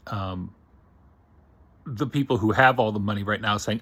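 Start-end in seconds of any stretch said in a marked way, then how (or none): none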